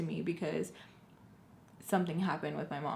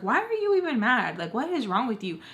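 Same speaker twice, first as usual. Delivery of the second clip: raised, high retort, retold; indignant back talk; 5 semitones higher, 6 dB louder than usual. Kid talking back at cussing mom, indignant. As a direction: open loud and high, indignant.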